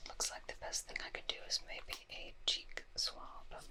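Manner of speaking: normal talking voice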